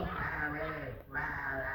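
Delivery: low, strangled duck sound saying "Larry"; choking duck. Retold or imitated. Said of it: "It'll go".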